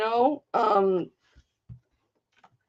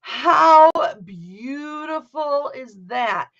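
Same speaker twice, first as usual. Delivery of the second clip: slow, loud, gushing admiration; excited exclamation; 2 semitones higher, 5 dB louder, much slower than usual. Real, not acted. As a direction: marvel at it out loud. Excited at seeing the house.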